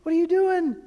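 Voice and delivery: a startled "what on earth" at Jesus; baffled outburst. Retold or imitated. Preacher retells his own reaction reading Jesus, mock baffled. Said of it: "and I was like".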